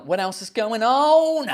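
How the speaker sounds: drawn-out mock-dramatic repeat; hammed-up question. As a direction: stretch the last word, swooping high.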